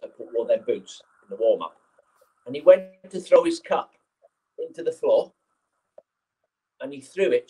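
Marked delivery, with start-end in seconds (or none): none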